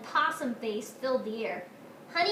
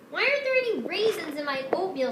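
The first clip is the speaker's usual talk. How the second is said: higher, louder, fast demanding whine; 6 semitones higher, 5 dB louder, faster than usual. A narrator's reading. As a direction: demand it like a spoiled child. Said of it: "she demanded".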